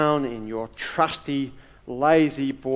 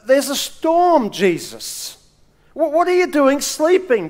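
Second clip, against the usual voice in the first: high, loud frightened cry to a sleeping Jesus; alarmed plea; 8 semitones higher, 4 dB louder than usual. Retold or imitated. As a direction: wake him in a panic, half acted.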